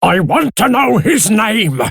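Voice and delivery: high, furious demand; outraged uncle's high-pitched shout; angry shout. A narrator's reading. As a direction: shout angrily, demanding an answer. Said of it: "Daniel shouted angrily".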